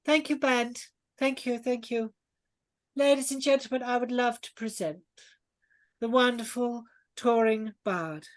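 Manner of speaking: high, projected hostess announcing an act; Lady Sletney. Acted. Hostess presenting the touring bard to guests, piercing. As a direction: introduce the star grandly in a piercing high voice. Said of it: "the piercing voice of Lady Sletney".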